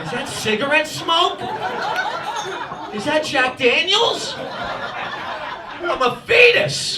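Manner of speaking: high, slow, indignant cry; mock-appalled unborn baby; outraged fetus. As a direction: protest loudly from inside the womb, drawing it out. Acting himself as outraged fetus.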